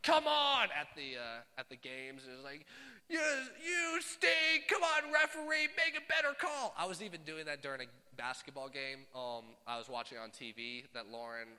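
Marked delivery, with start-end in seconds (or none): 0.0-0.7 loud, high heckle from a sports dad; sideline dad yell. Pretend. Dad at his kid's games, yelling in protest. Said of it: "one of those dads that's like". 3.1-6.7 high, loud shout at the referee; heckling sports dad. Pretend. Dad at the games berating the referee, shouting. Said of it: "one of those dads that's like".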